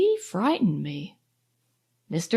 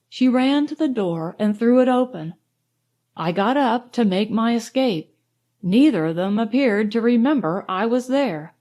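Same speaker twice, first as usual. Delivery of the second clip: usual speaking voice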